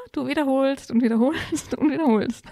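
amused, laughing through the repeats; laughing repetition. Real, not acted. Laughs over endless repetition. (lachend wiederholt)

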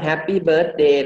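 "happy birthday" in a Thai accent, no r; Thai-accented bird-day; too-Thai accent. Shown as a demonstration. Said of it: "มันสำเนียงไทยเกินไป".

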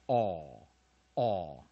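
"aw" as one long, loud vowel; alternative OU sound. Shown as a demonstration. Teacher giving the other reading of OU, "aw" long and emphatic. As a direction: say "aw" twice, drawn out and separated. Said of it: "或者是O U可以念".

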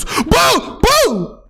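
high-pitched startled yelling; shocked, rising yells; alarmed shout. Acted. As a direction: cry out in alarm to stop someone. Startled by Magdalena's sudden act.

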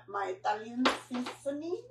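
soft, badly faked Italian accent; poor Italian accent. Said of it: "a really poor attempt at an Italian accent".

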